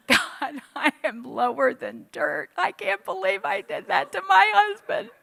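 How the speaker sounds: rising into a high tearful wail; high, crying self-reproach. Retold or imitated. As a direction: sob it out, voice climbing high. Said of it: "I mean, I was crying".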